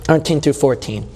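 normal talking voice

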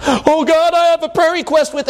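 high-pitched mock petitioner; hammed-up prayer request; pleading worshipper. Acting out someone petitioning God.

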